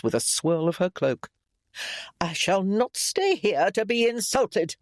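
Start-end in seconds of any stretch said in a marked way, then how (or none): 2.2-4.8 higher, indignant put-on voice; affronted matriarch. A narrator's reading. Mother rising to leave her son's house, affronted. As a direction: declare it with offended dignity, pitched up.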